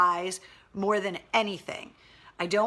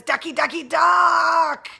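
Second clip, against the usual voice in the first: rising, much louder silly chant; playful shout; 8 semitones higher, 10 dB louder, slower than usual. Acted. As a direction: build to a shouted duck. Reading silly typo comment.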